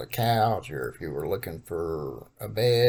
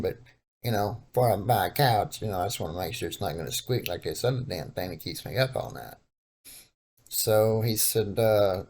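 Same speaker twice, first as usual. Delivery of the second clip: normal talking voice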